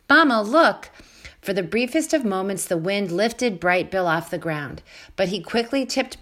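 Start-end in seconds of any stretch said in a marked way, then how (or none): 0.1-0.9 high, loud little gosling cry; excited call. A narrator's reading. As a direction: call out to mama, thrilled.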